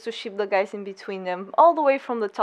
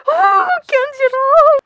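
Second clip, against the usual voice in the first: high, loud, drawn-out swoon; real excited squeal; 14 semitones higher, 7 dB louder, slower than usual. Real, not acted. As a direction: wail the name in fangirl delight. Swooning over voice actor.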